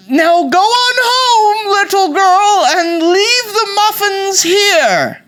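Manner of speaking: high, loud, drawn-out granny wail; fake British grandmother. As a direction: shoo her home in slow British falsetto. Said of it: "the voice from under the covers said".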